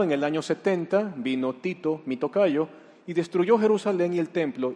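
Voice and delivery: ordinary talk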